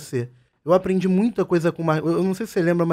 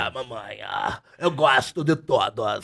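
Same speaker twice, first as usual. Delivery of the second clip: low, slow, drawn-out put-on voice; Grace; 8 semitones lower, 4 dB quieter, much slower than usual. Host imitates a colleague they all adore, low drawling impression. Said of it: "Você é a Grace".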